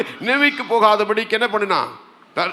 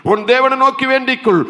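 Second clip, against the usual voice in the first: raised, forceful urging; insistent sailor; 6 semitones higher, 5 dB louder than usual. Acted. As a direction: press the sleeper to pray with a lifted voice. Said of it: "மாலுமி போய் சொல்லுகிறா". (குரலை உயர்த்தி வலியுறுத்தல்)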